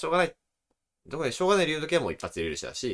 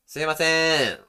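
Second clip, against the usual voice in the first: loud, higher hail with a long held ending; long, projected summons; stretched-out call; 5 semitones higher, 6 dB louder, slower than usual. Shown as a demonstration. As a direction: demonstrate calling out and sustaining the last syllable. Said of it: "伸ばすっていうのはあります".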